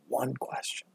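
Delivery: whispered, dropping to nothing; storyteller landing the moral, whispered; whispered punchline. Real, not acted. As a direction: breathe the last words out.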